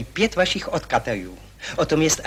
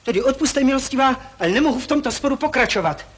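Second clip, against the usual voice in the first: agitated outburst; 8 semitones higher than usual. Acted. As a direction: sputter it out, heart pounding. Agitated man claims palpitations.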